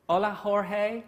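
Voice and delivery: put-on high, loud Spanish in a heavy American accent. Project manager greets developer Jorge, high American-accented Spanish. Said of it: "doing it in American accent".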